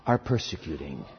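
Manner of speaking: low, soft, grave finish; solemn divine accusation; voice of Jesus. Acted. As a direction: drop to a deep hush. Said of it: "And the voice responds".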